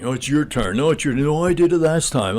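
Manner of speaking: a husband and wife arguing over whose turn it is, mock bickering; couple bickering. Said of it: "they kept fighting".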